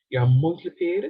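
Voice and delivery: usual speaking voice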